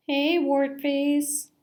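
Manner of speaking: drawn-out taunting fish voice; bully fish sneering at another fish; slow sneering bully. Acted. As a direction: drag out the insult like a bully.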